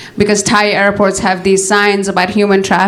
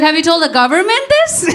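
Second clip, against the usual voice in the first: mock incredulous; 7 semitones higher than usual. Comic quoting herself to friend.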